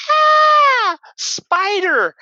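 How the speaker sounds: hammed-up terrified shriek; shrill scream. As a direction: mimic a grown man shrieking at a spider. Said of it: "shrill like a woman".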